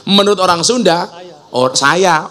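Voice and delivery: ordinary talk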